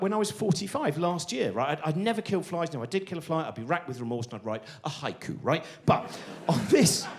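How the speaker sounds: normal talking voice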